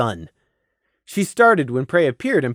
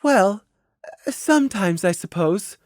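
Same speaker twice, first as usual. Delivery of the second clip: higher hesitant voice, dropping away; trailing off; 6 semitones higher than usual. Acted. Mare evading a child's question about magic, trailing off. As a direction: falter and let it fade. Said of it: "she trailed off".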